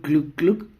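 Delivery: ordinary delivery, nothing marked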